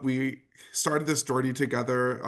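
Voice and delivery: normal talking voice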